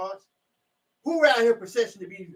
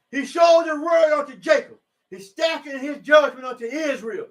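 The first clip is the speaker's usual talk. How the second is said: higher, louder proclaiming; raised preaching voice on the verse; loud scripture reading; 5 semitones higher, 3 dB louder than usual. Real, not acted. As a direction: cry the verse out to the church.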